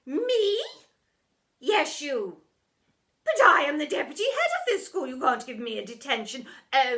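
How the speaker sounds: high and loud deputy; bossy deputy arguing with herself. A narrator's reading. Deputy arguing with herself, loud and high.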